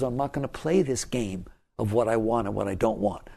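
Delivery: ordinary delivery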